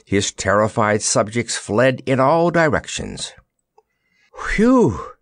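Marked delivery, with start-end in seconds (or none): 4.6-5.1 high, loud whew of relief; a sudden high whew after a narrow escape; relieved exclamation. Acted. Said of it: "exclaimed the Wizard".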